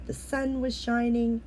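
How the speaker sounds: ordinary delivery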